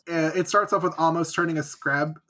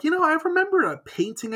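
Amos voiced; 12 semitones higher than usual. Voicing Amos.

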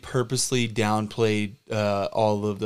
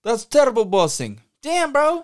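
much higher, louder scolding squeal; shrieking disbelief; 12 semitones higher, 6 dB louder than usual. Acted. Partner scolding the boss, shrill shocked disbelief.

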